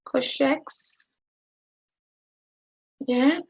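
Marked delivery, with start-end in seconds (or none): 0.0-1.1 "Cosh x" with sh closing the first word; lifted "Cosh x", rising on the x; cosh as one word. Shown as a demonstration. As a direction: say "Cosh" as one syllable, then x. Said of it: "it's pronounced cosh".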